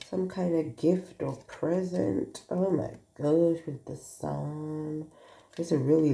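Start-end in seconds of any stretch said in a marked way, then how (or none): none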